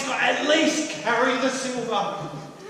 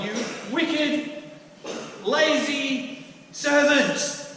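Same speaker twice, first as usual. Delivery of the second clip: harsh master; 6 semitones higher, much slower than usual. Acted. Master rebuking his servant, slow and stern. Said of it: "I was a bit harsh".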